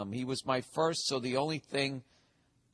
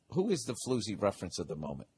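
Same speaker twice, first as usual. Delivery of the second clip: ordinary delivery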